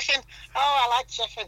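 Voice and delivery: high, bright Scouse voice; Liverpool accent. Shown as a demonstration. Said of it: "the Liverpool accent".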